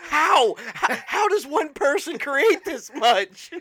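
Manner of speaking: stunned detective; disbelief. Pretend. Acted investigator disbelief.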